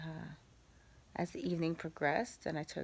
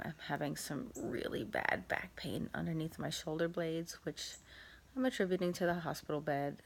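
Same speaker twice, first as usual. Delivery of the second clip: ordinary delivery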